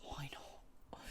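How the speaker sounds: breathy whisper, almost no voice; whispered why not. Retold or imitated. After brunch with friends, whispering to herself. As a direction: whisper it like a sly little dare. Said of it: "I'm just like".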